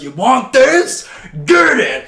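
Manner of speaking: loud, high, slow mock taunt; taunting bully. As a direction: shout it like a bully teasing kids. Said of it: "And he goes".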